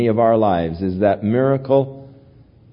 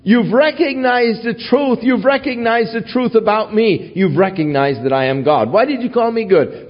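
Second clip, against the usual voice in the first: higher, urgent insistence; preacher voices Jesus pressing the young man, higher and fervent; fervent paraphrase; 10 semitones higher than usual. Retold or imitated. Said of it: "He was saying".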